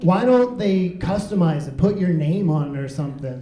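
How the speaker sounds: normal talking voice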